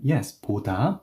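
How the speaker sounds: ordinary talk, nothing marked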